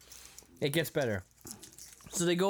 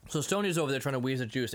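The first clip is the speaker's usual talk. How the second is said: ordinary talk, nothing marked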